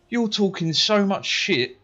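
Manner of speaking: high, drawn-out mock yell; retold shouting. Pupil swearing at teacher in a row, mildly shouted. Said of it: "Started screaming and shouting".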